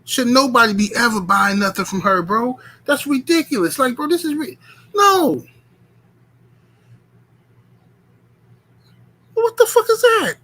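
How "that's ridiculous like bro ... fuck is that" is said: rising higher into a squeaky cry; outraged disbelief. Real, not acted. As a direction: build up to a high-pitched what the fuck. Outraged rant climbs.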